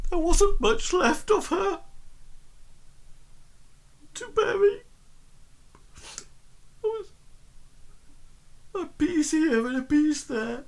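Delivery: very high, thin voice of a distressed woman; high-pitched, upset and halting, with long pauses. Acted. Upset woman telling Bartleby what was left of her, high and halting. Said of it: "she is getting, um, upset, and she sort of, like, says".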